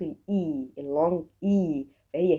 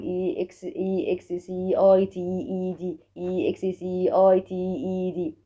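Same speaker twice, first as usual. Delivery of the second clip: monotone letter-name recital; rote letter-by-letter spelling. Shown as a demonstration. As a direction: reel off the letters like rote memorising. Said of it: "مش هقعد أقول". (تهجئة آلية حرف حرف بنبرة واحدة)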